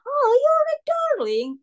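very high, loud Oh with darling; London street greeting; London accent imitated. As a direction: coo it like an old Londoner. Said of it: "the accent is probably, like, strong".

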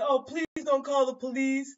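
lightly voiced frightened mother; pleading mother. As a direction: beg the driver not to call. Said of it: "she said".

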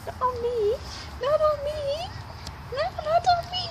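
high-pitched playful protest; pet-talk pleading. Real, not acted. Telling the pet not to jump up, high pleading. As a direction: plead up high with an eager animal.

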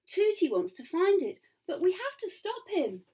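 high, anxious insistence; urgent plea. Acted. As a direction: press them to act. Worried sister urges action.

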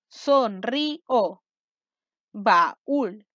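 very slow, each syllable sounded out separately; teacher's slow syllabified dictation. Shown as a demonstration. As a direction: break every word into drawn-out syllables. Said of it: "Separa la sílaba de las siguientes palabras".

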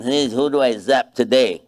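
lightly voiced cranky old man; a hint of an old grump looking for someone to zap; grumpy old man. Pastor mocking the idea of an angry old god, lightly voiced. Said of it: "angry viejito in heaven".

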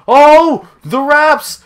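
very high, loud exclamation; thrilled high-pitched yell; excited shout. Real, not acted. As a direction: burst out at a sudden game surprise. Sudden reaction to game event.

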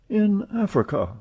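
high, wondering repeat; amazed echo. Acted. Passepartout echoing Fix in amazement, high. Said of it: "repeated Passepartout".

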